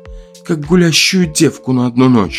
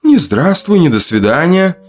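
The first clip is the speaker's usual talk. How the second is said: high-pitched teasing reproach; mocking, chuckling; 10 semitones higher than usual. A narrator's reading. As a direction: needle him with light mockery. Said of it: "посмеивалась Люда в пальто". (насмешливо, высоким голосом)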